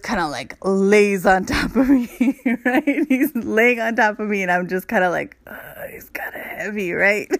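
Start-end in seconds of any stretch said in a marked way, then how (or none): 5.5-7.3 much softer, hushed whisper; mock hushed complaint under her breath; whispered aside. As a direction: whisper it like he might hear. Aside crushed under partner.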